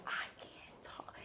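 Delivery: hushed, voiceless retold whisper. Friend secretly calling from the restroom, imitated whisper. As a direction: whisper it so nobody else hears. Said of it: "I'm whispering".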